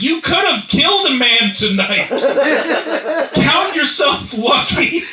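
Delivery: high, loud exasperated yell; indignant retort. Acted. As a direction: shout it in disbelief at his priorities. Outraged retort to self-pitying driver.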